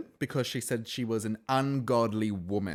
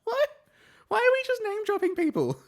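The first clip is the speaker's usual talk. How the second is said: much higher, louder exasperated question; baffled outburst; 5 dB louder than usual. Real, not acted. Vlogger reacting to [name]'s [name] remark, baffled outburst. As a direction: shoot it up in disbelief.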